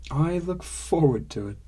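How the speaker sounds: ordinary delivery, nothing marked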